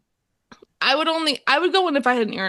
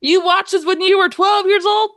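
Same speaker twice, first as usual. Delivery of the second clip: mock shocked question; 9 semitones higher, 5 dB louder than usual. Real, not acted. Teasing co-host about age.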